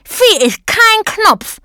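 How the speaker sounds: very high, slow bellow. A narrator's reading. Button policeman declares Emma no button, bellowing. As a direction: roar it out high, stretching each word. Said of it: "brüllte der Polizist".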